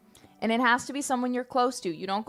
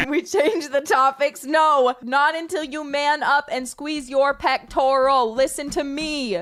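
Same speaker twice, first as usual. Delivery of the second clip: louder and higher, laughing bossy refusal; loud insistence; 4 semitones higher, 5 dB louder than usual. Real, not acted. Streamer loudly refusing chat's plea to change topic. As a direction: push back on chat, half laughing.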